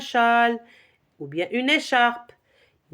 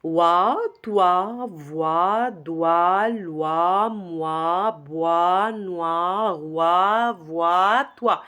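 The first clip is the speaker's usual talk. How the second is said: syllables chained without pauses; continuous chant-like drill, lower pitch; rapid repetition; 4 semitones lower, much slower than usual. Shown as a demonstration. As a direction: run the syllables into one lilting string. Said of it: "vous pouvez les répéter rapidement".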